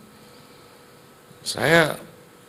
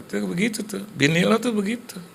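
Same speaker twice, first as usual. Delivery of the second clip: high, needling tempter; devil's voice; 10 semitones higher than usual. Acted. Devil needles husband about his wife, high taunting voice. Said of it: "iblisnya masuk lagi".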